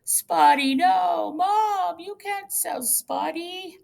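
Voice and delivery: put-on a heartbroken boy crying out to his mom; kid crying out. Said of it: "Now he started crying".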